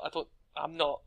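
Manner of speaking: ordinary talk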